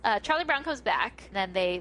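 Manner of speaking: ordinary talk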